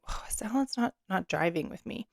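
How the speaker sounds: soft aside from your own gut; quiet inner voice. Retold or imitated. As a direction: let the gut reply under your breath. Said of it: "something in you just is like".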